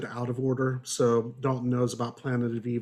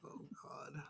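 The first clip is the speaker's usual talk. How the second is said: breathy aside under the breath; a muttered groan of disbelief; exasperated whisper; 26 dB quieter than usual. Real, not acted. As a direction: whisper it in mock despair. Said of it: "And it's like".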